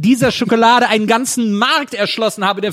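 indignant. Real, not acted. Indignant rant continues.

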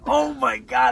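high, loud, gushing reaction; retold delight. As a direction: exclaim it as if thrilled, replaying the moment. Retells own delighted reaction.

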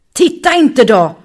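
loud, high, angry roar. Acted. Muffin ryter åt Bonki som vänder huvudet, argt. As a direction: roar the warning at her. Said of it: "röt Muffin".

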